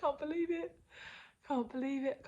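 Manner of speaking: high, giddy repetition; can barely believe it; disbelieving excitement. Real, not acted. Overjoyed mother telling viewers about the move, giddy. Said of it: "I'm so excited".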